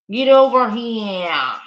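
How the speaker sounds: loud mock Boston cop; mock Boston accent, loud. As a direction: belt "Get over here." in a thick Boston accent, like a Boston cop. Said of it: "that is the most Boston thing".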